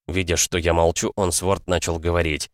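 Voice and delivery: plain reading voice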